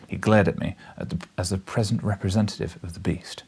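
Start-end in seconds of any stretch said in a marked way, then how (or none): none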